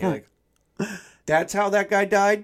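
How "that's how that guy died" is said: high, loud incredulous question; acted astonishment, pitched up; mock disbelief. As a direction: play a shocked news reader reacting. Voicing shocked headline reader.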